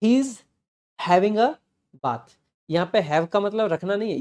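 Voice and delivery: normal talking voice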